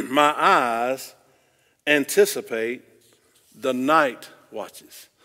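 loud, spaced-out phrases with pauses; emphatic scripture reading. Acted. Preacher showing congregation the next verse, emphatic deliberate reading. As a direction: declaim it piece by piece, drawing out the end.

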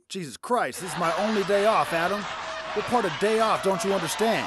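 loud, high exasperated scolding; irritated God. Retold or imitated. God annoyed at Adam, loud and high. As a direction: snap at a pest on your day off. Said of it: "And God's like".

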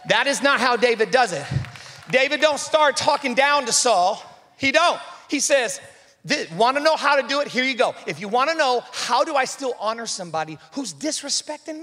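loud, high, driving sermon delivery; full-force preaching. Acted. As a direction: preach it hard, building the crowd up.

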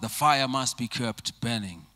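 normal talking voice

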